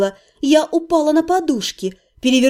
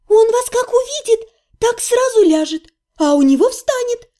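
higher, chirpy saleswoman promising; saleswoman's voice; 8 semitones higher than usual. A narrator's reading. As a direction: sell it like a cheeky shopgirl. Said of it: "обещала мне продавщица".

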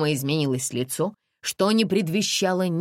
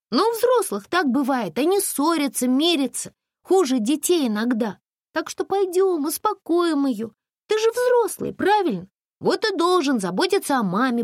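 put-on higher, gently persuasive girl voice; Masha coaxing a boy; 11 semitones higher, 4 dB louder than usual. Girl coaxing lost boy to go back to his worried mother.

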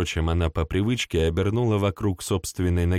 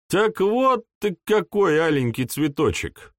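smirking; 9 semitones higher than usual. A narrator's reading. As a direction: tease her with a smirk. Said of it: "усмехнулся Артур".

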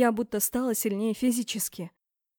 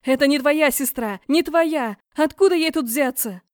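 very loud, high frantic shouting; screaming; 5 semitones higher, 10 dB louder than usual. A narrator's reading. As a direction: shout her down urgently. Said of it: "закричала". (громкий, отчаянный крик)

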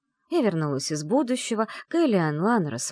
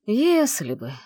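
wistful, pitched up then falling; sighing regret; 5 semitones higher, 4 dB louder, slower than usual. A narrator's reading. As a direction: sigh it out with mild regret. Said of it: "вздохнул он". (с лёгким вздохом сожаления)

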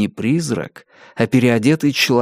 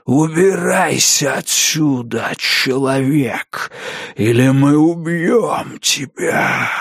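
slow, eerie ghostly threat; menacing ghost voice; slower than usual. A narrator's reading. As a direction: drag out each word like a spirit.

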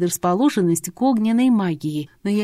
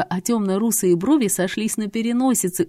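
normal talking voice

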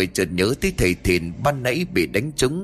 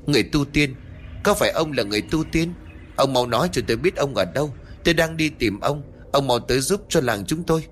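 higher, fast, eager cries; urgent calling out; 4 semitones higher, faster than usual. Acted. Phi calls out eagerly to the hidden immortal, higher and fast. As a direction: call out urgently to someone hidden. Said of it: "nó thốt lên".

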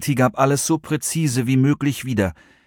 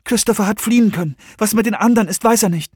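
high, urgent relaying of news; hurried, agitated and high; hasty explanation; 11 semitones higher, faster than usual. A narrator's reading. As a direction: rattle off what happened. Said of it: "erklärte Serenity ihr hastig".

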